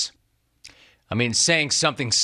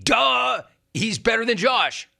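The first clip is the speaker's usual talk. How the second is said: high, dismissive "Duh, he's better than Josh"; mocking "duh"; 8 semitones higher than usual. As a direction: scoff it like the answer is obvious. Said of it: "he's laughing".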